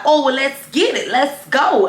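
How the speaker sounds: hyped-up naive bravado; eager. Retold or imitated. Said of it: "I'm like".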